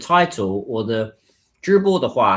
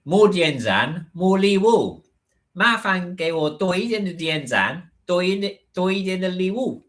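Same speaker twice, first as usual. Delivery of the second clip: playful begging; 7 semitones higher than usual. Pretend. Begging viewers for likes, gifts.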